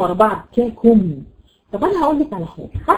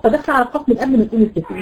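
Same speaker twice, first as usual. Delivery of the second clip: ordinary delivery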